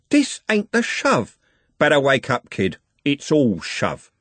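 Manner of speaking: high, drawn-out scornful comeback; defiant retort. A narrator's reading. Trev answering the gang leader's threat, defiant. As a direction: start high and slow, then settle.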